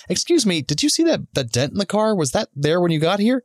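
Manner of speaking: overly polite question. Retold or imitated. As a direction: put on a sweet, innocent voice to ask.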